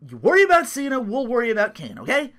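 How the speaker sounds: loud, high "Enough!" then bossy orders; Triple H shouting. Acted. Triple H shouting down Seth, loud bossy command. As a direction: cut him off like an angry boss. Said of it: "And Triple H's like".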